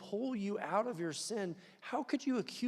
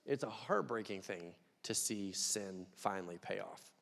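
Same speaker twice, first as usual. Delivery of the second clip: sorrowful; 6 semitones lower, 6 dB quieter than usual. Real, not acted. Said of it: "grieving the dog".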